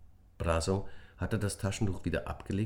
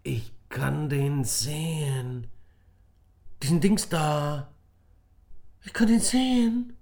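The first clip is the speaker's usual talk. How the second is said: higher, louder astonished shout; can't believe your eyes; boy calling out in amazement; amazed call; 10 semitones higher, 6 dB louder, slower than usual. A narrator's reading. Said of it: "rief Emir".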